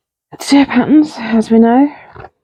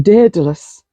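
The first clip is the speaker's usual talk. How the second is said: "Daedalus" said the way Kelly says it, starting DED-a-lus; a friend's accent on "Daedalus". Retold or imitated. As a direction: imitate Kelly saying "Daedalus". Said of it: "said that in a Kelly accent".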